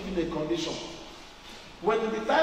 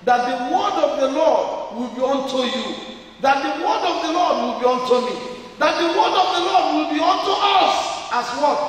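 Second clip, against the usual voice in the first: rising higher and louder to a shout; building shout; 6 semitones higher, 5 dB louder than usual. Real, not acted. Preacher calling the church to answer, climbing to a shout.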